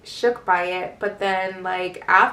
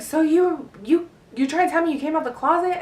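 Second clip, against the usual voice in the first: higher, stumbling question; incredulous mom; 7 semitones higher than usual. Retold or imitated. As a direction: imitate a mother struggling to believe it.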